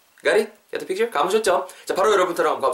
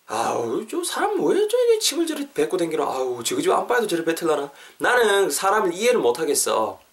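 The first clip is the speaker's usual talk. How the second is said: exasperated grumble; 3 semitones higher than usual. Acted. Teacher playing a bystander appalled at someone spitting, exasperated. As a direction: grumble in disbelief, voice leaping up.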